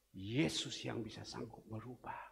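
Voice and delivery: ordinary talk, nothing marked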